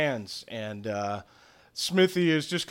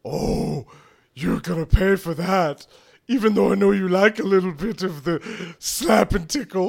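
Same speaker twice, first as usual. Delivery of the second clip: raised, theatrical Smithy threat; higher, louder gloating voice; taunting villain; 5 semitones higher, 5 dB louder than usual. Acted. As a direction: threaten with teasing relish. Smithy threatens after being spat on.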